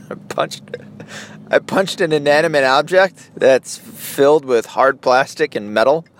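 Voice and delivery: ordinary talk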